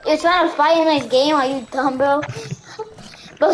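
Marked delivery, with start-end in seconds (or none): none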